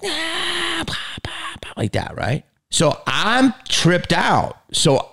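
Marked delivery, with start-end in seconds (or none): 0.0-1.8 breathy whispered pop pop pop; whispered gunshot pops. Retold or imitated. Actor imitating firing blanks, whispered pops. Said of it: "And I'm like".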